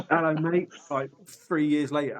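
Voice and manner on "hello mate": mock cheery greeting. Mimicking greeting forgotten name.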